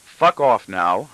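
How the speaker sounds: ordinary delivery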